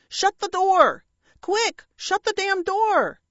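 high, loud, alarmed command; urgent yell. Acted. Parrot warning as crows approach, urgent yell. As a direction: yell it urgently, drawing out the repeat.

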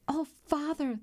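high-pitched, pleading daughter, voiced by narrator; distressed plea. A narrator's reading. Girl brings her father bad news, distressed plea.

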